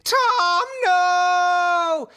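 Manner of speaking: very high, loud little scream; tiny fly scream. Pretend. Dying flies crying out to the killer, high shrieks.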